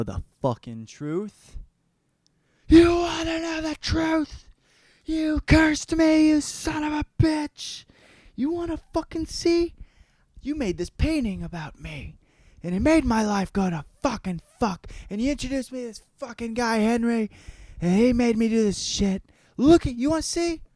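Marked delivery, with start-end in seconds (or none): 2.7-9.7 full-throated, hammed-up raging shriek; enraged screaming. Dorian angrily confronts the painter. 10.4-20.6 high-pitched, worked-up tirade; hammed-up high, agitated outburst; frantic angry rant. Dorian's acted rant at the painter.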